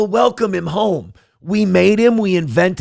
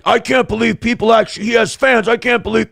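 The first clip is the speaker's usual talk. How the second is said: high, mock-outraged complaint; hammed-up disbelief of a mad hater; imitated indignation; 6 semitones higher than usual. Imitating Karmic, mad that people like Steel Toe, mock indignation. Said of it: "He gets mad".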